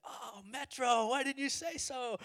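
very high, drawn-out friendly exclamation; effusive recognition. Retold or imitated. Gunman learns he is with Metro, high effusive welcome. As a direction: sing it out in delighted recognition.

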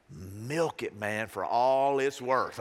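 slow, drawn-out advice; imitated wise friend. Friend coaching a patient to milk it, imitated sage. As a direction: stretch it out like a mock sage.

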